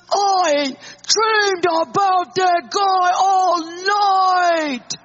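slow, loud, high furious yell; angry shout. Retold or imitated. Husband shouting to his wife about the emailer. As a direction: drag it out in a rage. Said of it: "stomping to the bathroom".

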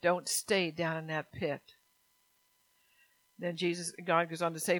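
normal talking voice

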